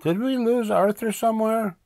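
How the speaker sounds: put-on very high little-girl voice; little sister yelling. A narrator's reading. Little sister asking Mom about missing brother, calling out. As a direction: pipe it up high to a parent. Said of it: "D W yelled".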